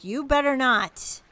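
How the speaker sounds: loud, warning scold. Acted. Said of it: "Griselda's walking in and like".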